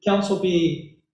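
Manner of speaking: ordinary delivery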